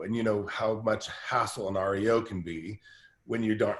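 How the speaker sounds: normal talking voice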